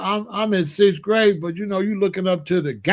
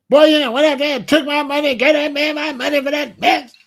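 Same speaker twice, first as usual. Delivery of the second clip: high, loud scolding mother; imitating a furious mother; 8 semitones higher, 5 dB louder than usual. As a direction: mimic mama chewing him out. Mother scolding about wasted money.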